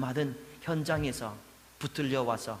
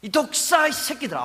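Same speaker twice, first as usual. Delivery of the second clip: rising fury, much higher and louder on the second cry; escalating condemnation, pitch climbing; angry rebuke; 8 semitones higher, 6 dB louder than usual. Acted. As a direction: thunder it at the hypocrites. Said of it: "아마 이렇게 이야기하시는 겁니다".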